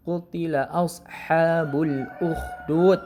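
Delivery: slow recited "Qutila ashabul ukhdud" with a rasping kh; recitation. Shown as a demonstration. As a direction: chant it slowly and carefully. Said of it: "Itu kan kho".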